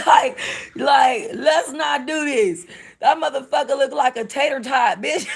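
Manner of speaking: squealing in disgust at the screen; laughing shriek. Real, not acted. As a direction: shriek at the gross foot through laughter. Shrieking at the foot video.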